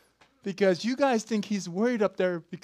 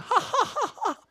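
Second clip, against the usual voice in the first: high-pitched mock ha-ha laugh; acted laughter; 3 dB louder than usual. God laughing in heaven, high acted laughter. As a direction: laugh out in high staccato bursts. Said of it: "they're laughing".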